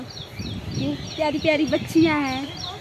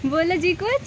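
normal talking voice

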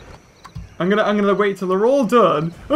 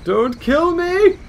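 higher, slow, drawn-out mock begging; mock dinosaur plea; 8 semitones higher, much slower than usual. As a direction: play the fleeing dinosaur begging. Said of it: "He's like".

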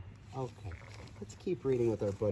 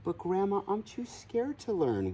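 whispered fear; 8 semitones higher than usual. Acted. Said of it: "whispered Gracie".

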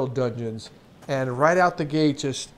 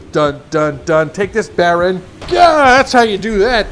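loud, hammed-up action hero; triumphant battle cry; 3 semitones higher, 9 dB louder than usual. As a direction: build from mock fanfare to loud triumph. Hammed-up attack on an enemy.

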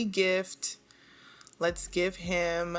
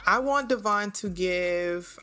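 usual speaking voice

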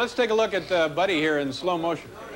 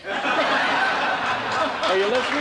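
higher and very slow, drawn out with a laugh; mock shopkeeper; 8 semitones higher, much slower than usual. Playing the clerk, host lectures the dog with a laugh. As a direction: scold the dog like a fussy store clerk. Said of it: "the last dog that came in here".

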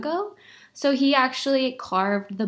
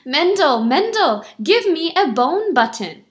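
loud, raised-pitch call; 6 semitones higher, 6 dB louder than usual. A narrator's reading. Shamus at the tailor's door, calling out loudly. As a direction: call through the door urgently. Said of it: "he called".

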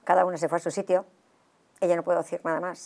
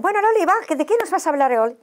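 much higher and louder, bubbly; lively friends; 10 semitones higher, 5 dB louder, faster than usual. Retold or imitated. Friends eagerly urging Loli to tell a story.

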